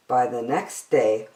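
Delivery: usual speaking voice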